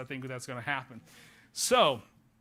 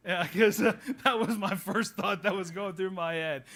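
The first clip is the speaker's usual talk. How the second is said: laughing through the words, louder; 3 semitones higher, 5 dB louder than usual. Real, not acted. Pastor laughing with congregation about his first thought, laughing. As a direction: chuckle it out at your own joke.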